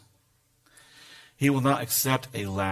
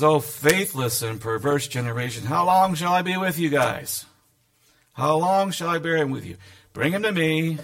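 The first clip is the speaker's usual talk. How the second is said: louder, higher, exasperated rebuke; 4 semitones higher, 5 dB louder than usual. Acted. Yahshua rebukes the faithless crowd, exasperated and loud. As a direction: throw up your hands at them. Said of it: "Yahshua gets exasperated and says".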